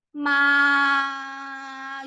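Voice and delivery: MA held long on one level pitch; MA sustained flat, no rise or fall; first tone. Shown as a demonstration. Teacher models the first tone of ma, long and level. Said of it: "this is the first tone".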